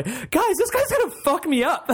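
high laughing alarm; laughing panic. Retold or imitated. Player warning friends on Skype, high laughing panic. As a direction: squeal it to friends through laughs. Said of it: "me going to Evan and Ollie".

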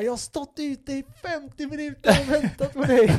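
high, hammed-up tearful outrage; crying, furious girlfriend. Girlfriend to boyfriend after waiting outside, mock tearful fury. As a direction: imitate a tearful angry girlfriend in a high-pitched wail. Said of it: "gråter och är C P-arg".

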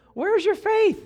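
high-pitched mock exasperation; exasperated rebuke. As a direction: shoot up high in a scolding question. Jesus rebukes the frightened disciples.

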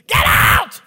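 loud, drawn-out imitated bellow; hammed-up furious shout; yelling old man. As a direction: roar it like an angry patient. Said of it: "And he's yelling".

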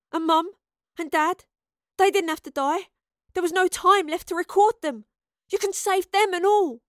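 high, loud, desperate urging; impassioned plea. Acted. Astrid begging the professor to save her parents, impassioned. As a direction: build to an urgent appeal.

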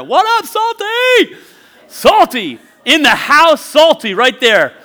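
loud, high hype shout; excited call-out. Real, not acted. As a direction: holler a big drawn-out greeting to the mascot. Calls out to mascot on screen.

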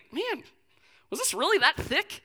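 high, puzzled mock disbelief; acted bewilderment. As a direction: wonder aloud with rising alarm. Acting out worry watching hair fall.

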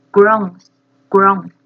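groans said loud and falling; emphatic word. Shown as a demonstration. Teacher loudly stressing the new word groans. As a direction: punch each groans out, loud. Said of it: "这个是瘫软的意思".

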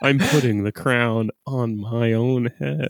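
slow, hollow, bored emperor voice; mopey Napoleon. Retold or imitated. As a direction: deliver it like a deflated tyrant. Imitating Phoenix's Napoleon.

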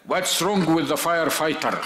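put-on slow, drawn-out American-accented whine; mock American kid. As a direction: imitate the son's slow American English. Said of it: "يقول لك". (تقليد ساخر بطيء للهجة أمريكية)

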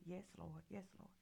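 soft, low under-breath praise; murmured prayer. Real, not acted. Pastor praising God under his breath, soft and low. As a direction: pray it quietly to yourself.

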